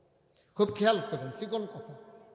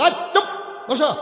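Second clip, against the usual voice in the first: loud, high burst of an order; retold sharp command; 9 semitones higher, 11 dB louder than usual. Prophet silencing two whisperers during his sermon, loud retold command. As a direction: cut across the whisperers with a sudden shout. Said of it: "নবী বলে".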